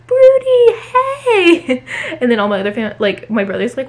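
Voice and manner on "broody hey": high, loud, laughing call; yelling the cat's name. Retold or imitated. Coming home and calling her cat, loud and high. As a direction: sing out his name with glee. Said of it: "I start yelling his name".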